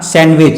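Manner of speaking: सैंडविच said as "san-wich"; silent D. Shown as a demonstration. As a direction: drop the d in सैंडविच. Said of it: "क्या बोलेंगे".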